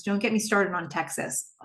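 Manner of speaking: dropped to a sly whisper for the final jab; conspiratorial whispered aside. Retold or imitated. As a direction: hiss the last line like a secret dig. Joe's sly jab at Donna.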